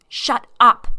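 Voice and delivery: loud, high scream; woman silencing her husband, screaming. Acted. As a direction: scream him down. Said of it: "I screamed".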